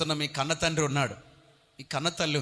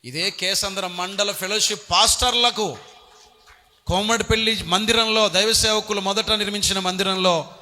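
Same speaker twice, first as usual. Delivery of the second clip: emphatic testimony; 5 semitones higher, 6 dB louder than usual. Real, not acted. Preacher stressing his testimony.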